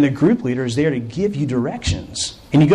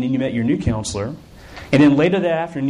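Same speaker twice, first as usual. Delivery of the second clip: ordinary delivery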